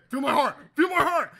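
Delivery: high, loud frantic mock plea; mock wired excitement. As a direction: play a caffeine-wired boyfriend yelling to his babe. Plays wired friend to his girlfriend.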